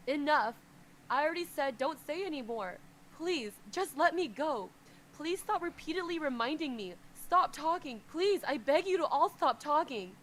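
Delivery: loud, high anguished roar. Acted. Kneeling master roaring at everyone to stop, loud. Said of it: "he roars".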